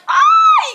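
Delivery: a short high loud yelp of glee; excited squeal. Retold or imitated. Telling viewers about fake dating, a high yelp. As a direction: yelp like the trope just landed.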